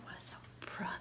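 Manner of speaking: hushed whisper to end the story. A narrator's reading. Reading to a child, whispered ending. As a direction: breathe it out softly as the last line.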